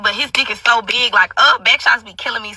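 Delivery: ordinary delivery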